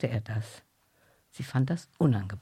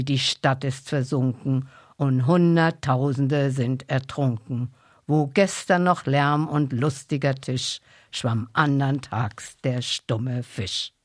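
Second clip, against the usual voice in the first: slow, louder solemn recitation; 5 dB louder, slower than usual. Acted. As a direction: recite it like a grave old ballad. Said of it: "mit getragener Stimme".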